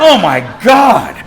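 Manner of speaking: drawn-out, loud "oh my God" in mock horror; overwhelmed dread. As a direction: act out being overwhelmed, loud and high. Dreading the crowd of greeters.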